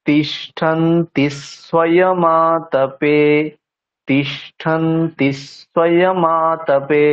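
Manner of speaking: very slow তিষ্ঠন্তি স্বয়মাতপে, stressing the first letter of each cluster. Shown as a demonstration. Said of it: "প্রথম বর্ণের উপরে stress দিচ্ছি". (তিষ্ঠন্তি স্বয়মাতপে খুব ধীরে, যুক্তাক্ষরের প্রথম বর্ণে জোর)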